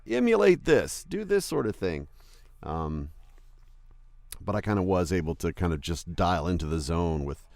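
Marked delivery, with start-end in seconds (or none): 0.1-2.1 imitating the director. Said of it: "he's kinda like a".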